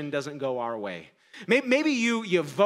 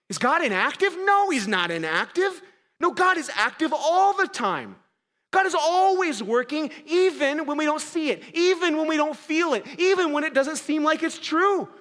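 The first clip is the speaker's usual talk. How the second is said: higher, louder preaching build; higher, louder emphatic sermon declaration; full-force preaching; 9 semitones higher, 4 dB louder than usual. Acted. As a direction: proclaim it with conviction.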